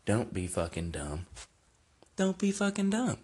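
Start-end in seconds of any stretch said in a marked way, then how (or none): 2.2-3.2 pitched much higher, mocking; high-pitched repeat. Warning Mayweather off MMA again, in a much higher mocking voice. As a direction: jump the voice way up in disbelief. Scolding a boxer.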